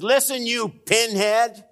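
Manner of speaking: high, loud, drawn-out scolding; indignant talking donkey. Acted. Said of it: "the donkey turns and in a human voice says".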